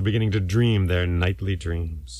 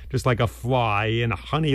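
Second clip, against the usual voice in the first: ordinary delivery, nothing marked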